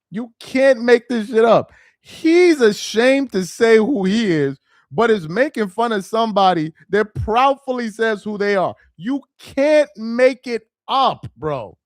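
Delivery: ordinary talk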